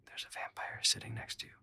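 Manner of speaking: secretive whisper to a friend. Retold or imitated. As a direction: breathe it out without voice. Whispering about vampire at party.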